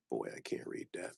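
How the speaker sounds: breathy whisper under the breath; whispered aside. Real, not acted. Seller squinting at an unreadable card, whispering to himself. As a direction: mutter to yourself, barely voiced. Squinting at a hard-to-read postcard.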